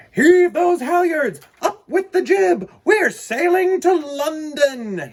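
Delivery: high, loud captain's orders; commanding cry. Acted. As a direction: bellow the orders to the crew. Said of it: "he cried".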